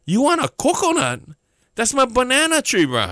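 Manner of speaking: high, disbelieving pushback; incredulous retort. Retold or imitated. Speaker to friend asking for a coconut, incredulous high retort.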